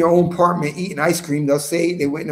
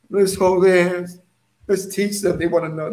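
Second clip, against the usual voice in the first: mocking soft-hearted brother. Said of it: "some Torah brothers be talking like that".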